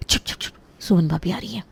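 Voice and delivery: soft, hurried shushing; urgent hush. Acted. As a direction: hush them before she walks in. Hushing as sister-in-law approaches. (धीमी घबराई फुसफुसाहट)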